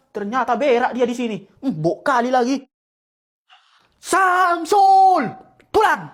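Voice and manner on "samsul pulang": sharp summons shout; calling out. Acted. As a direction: yell for the boy to come home. Father calls son home.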